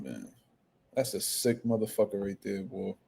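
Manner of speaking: usual speaking voice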